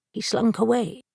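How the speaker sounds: ordinary delivery, nothing marked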